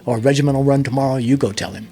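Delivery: loud, fast imitation of a commander; retold brisk order. Commander passing the job back to his pilot, brisk order.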